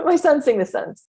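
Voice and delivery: giggling through the words; laughing. Real, not acted. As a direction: laugh as you fix the slip. Laughing at own word slip.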